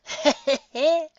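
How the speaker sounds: high, hearty cackling chuckle; old woman's chuckle. Acted. Old coot laughing at her own rambling, high chuckle. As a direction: let a warm old lady's laugh bubble up. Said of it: "Roanoke paused a moment and chuckled".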